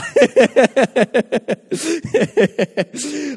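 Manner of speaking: long, high burst of laughter; sustained high-pitched giggling laugh; real hearty laugh. Real, not acted. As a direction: crack up at your own joke. Laughing at own joke to congregation.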